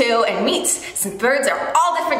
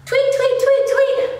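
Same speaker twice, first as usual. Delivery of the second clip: high chirpy tweet tweet; bird tweets; 9 semitones higher than usual. Shown as a demonstration. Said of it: "Can you sing some bird sounds with me?".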